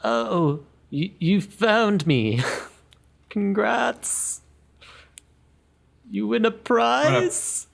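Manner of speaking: high, hesitant little creature voice; a timid hider who has just been found; meek voice. Acted. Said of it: "this meek voice".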